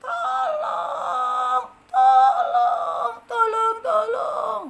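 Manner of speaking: high, loud crying tolong; tearful shouts for help. Acted. Ant desperately begs the butterfly. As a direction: cry out in panic.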